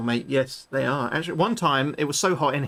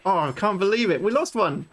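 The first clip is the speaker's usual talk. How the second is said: high-pitched cry of dismay; exasperated, raised-pitch outburst; dismayed disbelief; 5 semitones higher than usual. Real, not acted. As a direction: wail in disbelief at the loss. Gamer upset at losing one.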